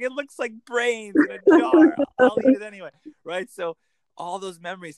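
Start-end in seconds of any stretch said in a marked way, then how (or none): 0.0-2.9 put-on high, loud old grandmother voice; a grandmother musing over a strange dish, loud and high.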